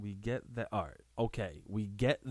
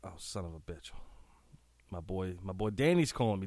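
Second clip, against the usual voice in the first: normal talking voice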